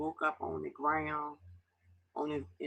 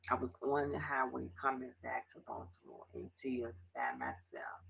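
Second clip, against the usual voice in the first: barely voiced, close to tears; hushing it out, holding back sobs; tearful whisper; 3 semitones lower, 4 dB quieter than usual. Real, not acted. Alone in her car, recalling the drive home in tears, whispered.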